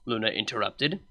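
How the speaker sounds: ordinary delivery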